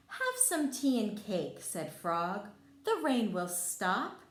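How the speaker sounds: put-on reading to children. A narrator's reading. Teacher reading Frog and Toad to kids, lively storybook voice. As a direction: read it out like a teacher at story time. Reads picture book to children.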